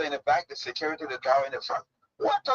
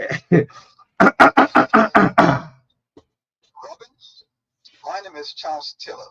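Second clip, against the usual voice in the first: Charles Taylor voice; 9 dB louder than usual. Pretend. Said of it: "let me hear the Charles Taylor voice".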